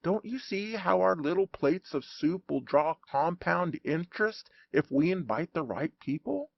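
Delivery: plain reading voice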